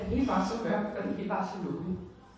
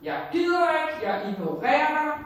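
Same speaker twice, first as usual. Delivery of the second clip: retold angry refusal, raised and loud; defiant outburst; 8 semitones higher, 8 dB louder than usual. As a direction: throw it at Jesus like a sulking rebel. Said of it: "der har jeg sagt".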